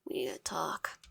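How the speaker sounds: hushed whisper in the dark. Acted. Cairo whispering to Wyatt at night, hushed. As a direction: breathe it out, barely voiced.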